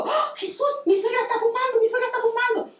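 high-pitched mock scream to Jesus; imitating her own startled yell; retold alarmed cry. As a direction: cry out in alarm, pitch leaping up, without real shouting volume. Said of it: "Entonces yo grito y le digo".

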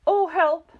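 high, loud cry for help; screamed. A narrator's reading. Missus Twit giddy on the ceiling, screaming for help. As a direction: shriek for help in panic. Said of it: "screamed Missus Twit".

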